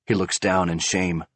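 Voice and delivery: usual speaking voice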